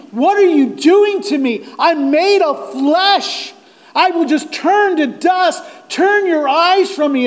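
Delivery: high, loud pleading with God; David's anguished plea. Acted. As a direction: plead in desperate protest. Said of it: "like David, is saying".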